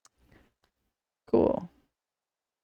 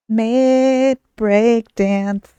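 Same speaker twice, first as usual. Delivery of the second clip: big, slow, higher title call; segment jingle announcement; 4 semitones higher, 5 dB louder, much slower than usual. Pretend. Host launching the mid-break dance segment, drawn-out announcement. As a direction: draw it out loud, every word stretched very long.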